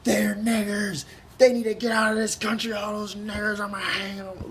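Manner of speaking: high slow mocking bigot voice. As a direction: caricature a hateful bigot, drawn out. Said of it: "if someone is like".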